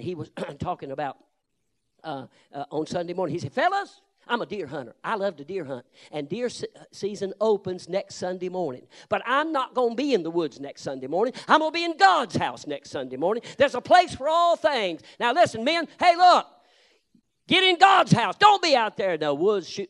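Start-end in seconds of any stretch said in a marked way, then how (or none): 3.5-15.0 quoted preacher building to a higher, louder call; retold preacher swelling higher and louder; preacher's rising exhortation. Preacher friend voiced exhorting men. 15.2-19.9 high, loud preacher's cry; full-force preaching yell; pulpit shout. Real, not acted. Preaching climax, calling out.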